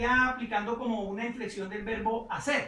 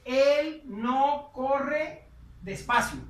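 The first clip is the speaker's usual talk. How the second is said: slow, louder model phrase; slow translation; 3 semitones higher, 5 dB louder, much slower than usual. Shown as a demonstration. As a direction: read it out slowly for learners. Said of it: "Ahí dice".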